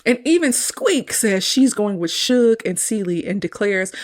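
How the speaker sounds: ordinary talk, nothing marked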